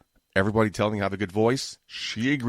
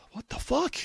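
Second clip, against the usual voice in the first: exasperated; 4 dB quieter than usual. Real, not acted. Exasperated outburst.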